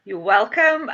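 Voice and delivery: ordinary delivery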